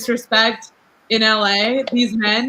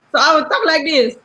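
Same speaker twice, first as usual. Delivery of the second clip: higher-pitched character voice; put-on act voice; 4 semitones higher than usual. Shown as a demonstration. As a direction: demonstrate a fake persona voice. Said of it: "talk in this voice".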